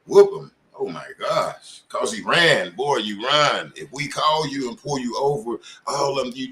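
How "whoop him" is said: retold order. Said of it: "this one here say".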